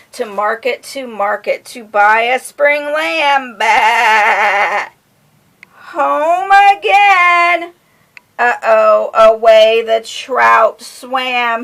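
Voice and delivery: sing-song picture-book reading; reading to children. A narrator's reading. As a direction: lilt it for a little listener.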